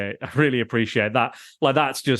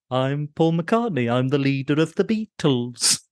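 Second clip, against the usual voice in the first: slow, put-on Liverpool accent Beatle; mock Liverpudlian accent; 3 semitones higher than usual. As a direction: announce yourself in a bad Liverpool accent. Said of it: "he's like".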